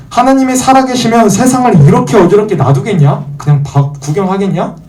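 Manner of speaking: high, indignant rhetorical question; acted-out doubter's protest; skeptical challenge. Acts out challenge from worldly skeptics.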